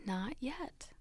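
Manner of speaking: soft whispered refusal. Acted. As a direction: whisper it, shaking your head. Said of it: "He shook his head".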